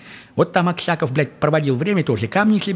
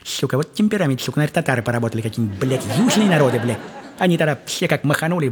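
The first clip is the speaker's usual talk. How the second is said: ordinary talk, nothing marked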